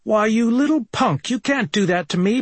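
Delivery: high, indignant outburst; outraged man. Acted. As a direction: play the outraged cheated husband. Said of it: "I being the outraged man".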